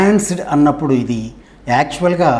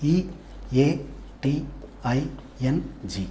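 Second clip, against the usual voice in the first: spelled slowly, letter by letter; spelling out; 9 dB quieter, much slower than usual. Shown as a demonstration. Teacher spelling a word for the class, spelled out slowly. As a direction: spell it out with a gap after each letter. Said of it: "eating అనే word ఉంది".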